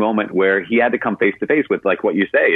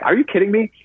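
higher, pointed comeback; sassy retort; 8 semitones higher than usual. Retold or imitated. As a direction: call out the double standard, sassy. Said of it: "this is a sassy woman".

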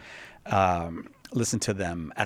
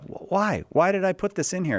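exasperated self-question; 8 semitones higher than usual. Retold or imitated. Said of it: "and then you say to yourself".

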